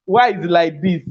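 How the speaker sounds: ordinary delivery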